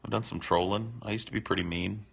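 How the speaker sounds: usual speaking voice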